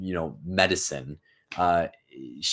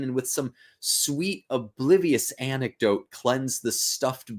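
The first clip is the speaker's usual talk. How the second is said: dramatic reading; 5 semitones higher than usual. Acted. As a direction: plead for a cure, slowly. Reads doctor and Macbeth lines.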